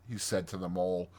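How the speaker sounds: ordinary delivery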